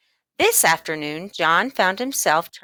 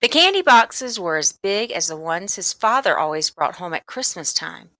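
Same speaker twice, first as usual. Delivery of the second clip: ordinary delivery, nothing marked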